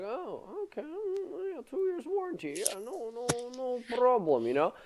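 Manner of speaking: very high mock salesman voice; squeaky put-on shop clerk; imitating salesman. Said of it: "And he's like".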